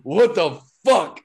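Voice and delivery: loud, high, stretched-out mock shout; exasperated coach impression, played for laughs; imitated coach yell. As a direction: yell it slowly like a fed-up coach. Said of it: "like, yelling at me".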